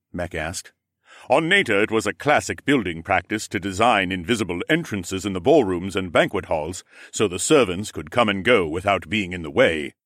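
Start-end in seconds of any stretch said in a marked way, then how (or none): 1.3-9.9 a hint of a different character voice; lightly voiced explainer. Mech explains.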